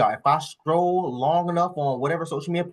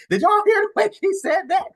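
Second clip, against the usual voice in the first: much higher, incredulous question; amazed; 3 dB louder than usual. Real, not acted. Asking viewers about the clip, high incredulous squeal. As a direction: squeal it in disbelief.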